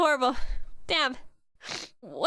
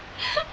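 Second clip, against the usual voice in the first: tearful sob; 12 semitones higher, 5 dB quieter than usual. Real, not acted. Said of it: "I'm sorry, I'm crying".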